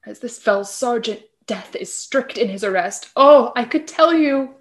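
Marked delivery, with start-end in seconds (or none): 3.1-4.6 anguished outburst. Acted. Dying Hamlet bursts out.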